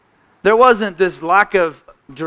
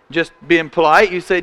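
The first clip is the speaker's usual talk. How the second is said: ordinary talk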